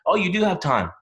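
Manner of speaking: mock dismissive reply. Coach contradicting a prospect, mock dismissive. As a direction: play the coach who waves it away. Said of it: "and you say".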